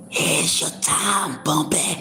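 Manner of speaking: low, soft, raspy whispered line; movie gangster's hoarse whisper. Retold or imitated. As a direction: rasp it out like a gangster with a shot voice. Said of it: "That's how I wanna be sounding".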